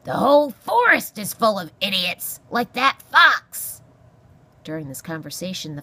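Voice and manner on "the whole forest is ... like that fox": loud, irascible porcupine voice; grumpy old porcupine. Acted. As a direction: scold as a crotchety old animal. Porcupine rants about the fox.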